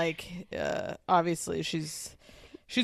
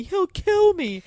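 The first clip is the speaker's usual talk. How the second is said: much higher, slow, fearful repeat; mock hysterical panic; 12 semitones higher, 6 dB louder, slower than usual. Retelling Tinsley's strange reaction, high mock panic. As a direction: ham up her terror. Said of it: "how Tinsley kept saying".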